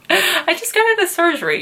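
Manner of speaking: quick, high-pitched protest; retold patient pleading the obvious; mock exasperation. Daughter to crying mom after surgery, mock exasperated.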